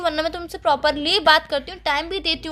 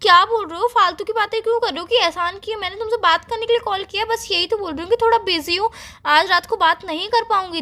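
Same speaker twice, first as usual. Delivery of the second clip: higher-pitched, exasperated comeback; indignant protest; 5 semitones higher than usual. Acted. Girlfriend objects to boyfriend's pointless talk, indignant. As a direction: push back, pitch raised in annoyance.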